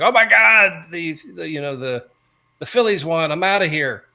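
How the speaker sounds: mock panic, high and loud; hammed-up outraged sports fan; mock fan quitting the country. Friend mocks people who flee the country, mock panic. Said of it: "It's like".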